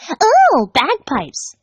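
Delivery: high, loud delighted ooh. Acted. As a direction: gasp with glee. Pinkie delighted.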